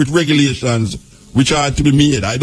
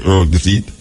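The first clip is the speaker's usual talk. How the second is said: normal talking voice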